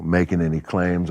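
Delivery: ordinary talk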